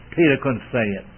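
normal talking voice

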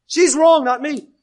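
loud, high, defensive outburst; indignant protest. Acted. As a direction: push the blame away, acting offended. Voices an indignant offended person.

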